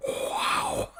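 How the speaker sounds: huge breathy, voiceless wow; over-the-top awestruck announcer; Vince McMahon impression. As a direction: gasp it out in hammed-up awe. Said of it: "Going full Vince McMahon".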